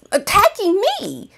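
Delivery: ordinary talk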